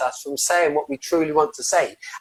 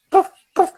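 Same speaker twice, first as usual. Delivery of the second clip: mock feeble puff-puff breathing, much higher; little puffs; 12 semitones higher than usual. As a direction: squeak out two tiny puffs like weak lungs. Lungs as shrivelled prunes.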